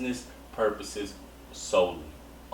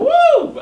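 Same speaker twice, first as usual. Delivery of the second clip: excited whoop; 15 dB louder than usual. Real, not acted. Excited whoop after singing.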